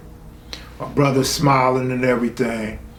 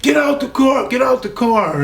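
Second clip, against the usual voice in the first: high-pitched imitation of yelled orders; retold police yell; 11 semitones higher than usual. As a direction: imitate officers yelling, pitch jumped way up. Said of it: "And they yelling".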